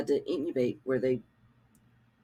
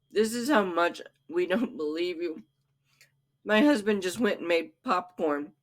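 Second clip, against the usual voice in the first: usual speaking voice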